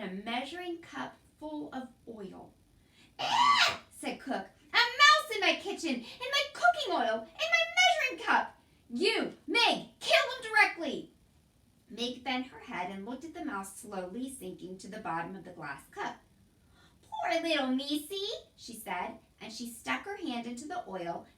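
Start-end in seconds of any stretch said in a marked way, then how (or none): 3.2-3.8 high, loud shriek of alarm. A narrator's reading. Cook shrieks on seeing a mouse in the oil. 4.7-11.1 high, loud outraged yelling; outraged shriek. A narrator's reading. Cook screeches at Mig to kill the mouse. 17.0-18.3 a touch of tender pity. A narrator's reading. Mig coos at mouse.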